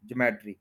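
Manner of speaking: ज्योमेट्री as juh-MET-ree; common mispronunciation. Shown as a demonstration. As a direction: say ज्योमेट्री in three syllables, stress on MET. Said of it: "ये word ज्योमेट्री नहीं है".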